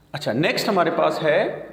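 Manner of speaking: usual speaking voice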